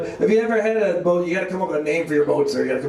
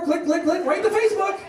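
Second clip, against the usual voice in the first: mock camera clicks. Mimics her snapping photos.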